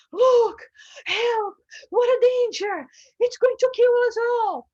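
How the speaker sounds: high, loud panicked cry for help; panicked scream. Retold or imitated. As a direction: scream that danger is coming. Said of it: "someone starts screaming".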